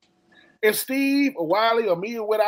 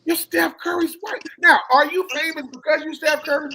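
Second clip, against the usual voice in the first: emphatic shout; 6 semitones higher than usual. Real, not acted. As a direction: bellow "You're" right at them. Telling wives off, lively rant.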